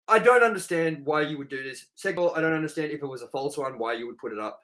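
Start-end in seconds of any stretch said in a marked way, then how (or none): none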